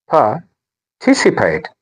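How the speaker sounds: "Participate" slowly broken up, par... TIS-ipate; stress on second syllable. Shown as a demonstration. Teacher breaking the word up for learners, "Participate" as par, TIS-ipate. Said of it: "The stress is on".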